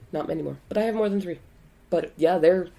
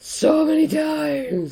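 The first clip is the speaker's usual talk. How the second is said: high, slow, drawn-out complaint; weary exasperated drawl; 11 semitones higher, 4 dB louder, much slower than usual. Real, not acted. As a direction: drag it out, worn down by repetition. Exasperated about repeated jokes.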